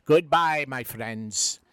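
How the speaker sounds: a lightly voiced stage Irish brogue; a hint of a cheeky Irish charmer; Patio Furniture Irish brogue. Irish visitor flirting with the lovely lass, in a light Irish brogue. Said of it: "It's me, Patio Furniture".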